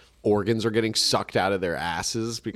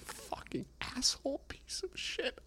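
whispered cursing; 12 semitones higher, 16 dB quieter than usual. Acted. Ruined gambler curses.

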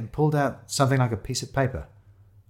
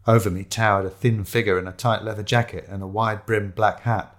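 ordinary delivery, nothing marked

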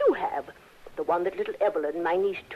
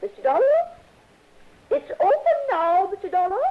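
higher and louder, calling through closed doors; 12 semitones higher, 5 dB louder than usual. Acted.

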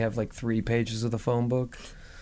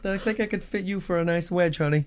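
mock shoe salesman; 5 semitones higher than usual.

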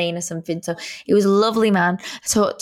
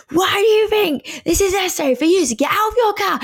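high-pitched, indignant scolding; retold angry rant; 10 semitones higher than usual. Passenger berating van man for stopping, indignant retold rant. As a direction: re-enact tearing into the driver, voice pitched up. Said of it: "I was just so angry".

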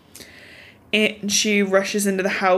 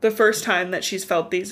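ordinary talk

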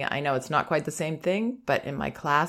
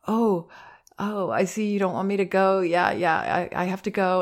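a mild, raised take on a wavering parent; insecure parent; 5 semitones higher than usual. Retold or imitated. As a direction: lightly imitate a parent who can't leave. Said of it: "the parent's insecure about it".

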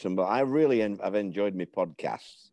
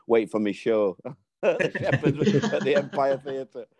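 laughing through words; 6 semitones higher than usual. Real, not acted. Guest laughs retelling manager's joke.

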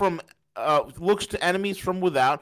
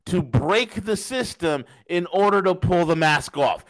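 normal talking voice

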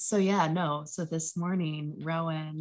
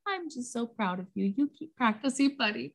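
proud mom; 7 semitones higher than usual. Retold or imitated. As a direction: re-enact gushing gently over your little boy. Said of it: "And I was like".

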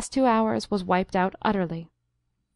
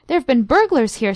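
higher, louder flustered housekeeper outburst; excited cry; 4 semitones higher, 6 dB louder than usual. A narrator's reading. Flustered housekeeper reports burglars to the doctor, excited cry. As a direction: blurt it out, all in a fluster. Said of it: "she cried excitedly".

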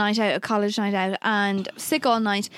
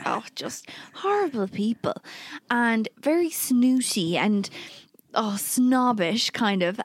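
ordinary talk

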